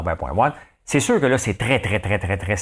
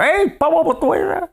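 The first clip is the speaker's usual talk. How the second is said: naysayer voice; 5 dB louder than usual. Retold or imitated. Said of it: "toujours quelqu'un".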